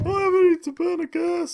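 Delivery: very high, squeaky put-on voice; goofy high-pitched whine; silly voice. Garbled silly voice after laugh.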